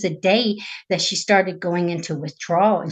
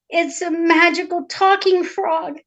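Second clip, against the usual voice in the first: higher, slow princess explanation; earnest princess; 7 semitones higher than usual. Acted. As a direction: insist slowly, pitched up a little. Princess explains to her father.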